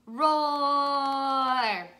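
sustained mezzo forte roar; medium-loud roar. Shown as a demonstration. Teacher demonstrates the medium dinosaur, medium-loud roar. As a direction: roar out at a full speaking volume. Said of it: "like a medium loud".

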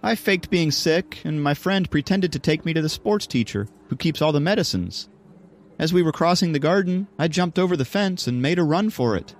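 high-pitched schoolgirl voice; high, girlish storytelling; Sophie, pleased with herself. Acted. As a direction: recount your sneaky escape in a high girlish voice. Sophie tells of her escape.

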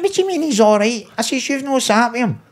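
incredulous. Retold or imitated. Incredulous reply to cellmate.

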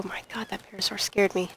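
breathless startled whisper. Real, not acted. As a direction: whisper after a fright. Startled by parasaur.

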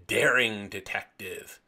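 ordinary talk, nothing marked

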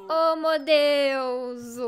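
loud, long drawn-out gush; overwhelmed exclamation. Real, not acted. Streamer gushes over hugging an animal, loud and overwhelmed. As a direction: stretch it out loudly in delight. Gushing at a cute game animal.

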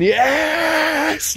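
excited yell. Retold or imitated. Crowd cheering at the decks with a high joyful whoop. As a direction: cheer at the DJ's mix. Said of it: "they were just going".

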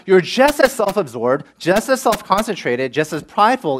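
usual speaking voice

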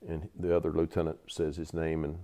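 ordinary delivery, nothing marked

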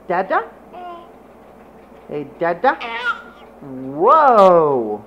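ordinary talk, nothing marked

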